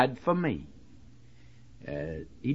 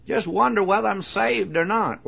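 mock doubter; 7 semitones higher than usual. Quoting doubting listener.

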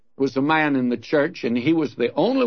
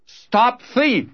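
high-pitched, slightly louder call-out; acted yell after a fleeing thief; mock yell; 9 semitones higher, 3 dB louder than usual. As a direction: call it out like raising the alarm. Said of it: "then somebody yell out".

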